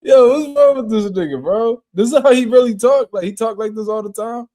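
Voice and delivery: high, loud disbelief, almost laughing; stunned reaction to an outrageous clip; incredulous. Real, not acted. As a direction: shout your disbelief at what you just heard.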